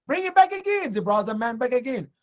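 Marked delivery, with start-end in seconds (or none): 0.1-0.9 high-pitched mock summons; summoning official. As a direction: call for him as the Pharisees, voice raised high. Pharisees summon the man.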